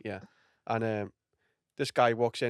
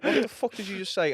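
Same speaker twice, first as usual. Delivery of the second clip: angry comic; 8 semitones higher than usual. Retold or imitated. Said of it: "And he went".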